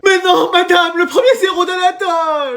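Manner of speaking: high-pitched wail. Acted. Pupil wails over first zero.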